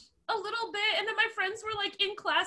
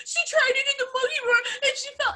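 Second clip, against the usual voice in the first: put-on frantic kids; 9 semitones higher, 3 dB louder, faster than usual. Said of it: "the boys are like".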